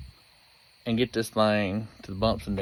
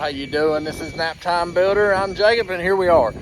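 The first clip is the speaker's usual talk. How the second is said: yelling over leaf blower; 5 semitones higher, 6 dB louder than usual. Real, not acted.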